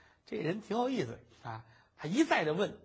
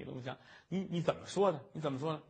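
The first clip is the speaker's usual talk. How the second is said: plain reading voice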